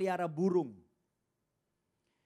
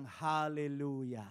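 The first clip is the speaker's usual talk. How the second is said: slow devout praise; 4 semitones lower, 3 dB quieter, much slower than usual. Retold or imitated. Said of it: "dia bilang".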